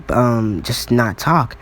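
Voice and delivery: ordinary delivery, nothing marked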